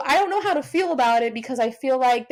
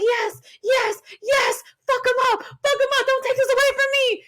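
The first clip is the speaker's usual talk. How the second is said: excited cheering; 12 semitones higher than usual. Retold or imitated. Fan cheering a wrestling run-in, excited yelling. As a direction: cheer like the match is saving you. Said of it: "I was just like".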